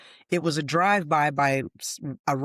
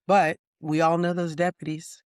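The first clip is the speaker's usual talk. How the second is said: ordinary talk, nothing marked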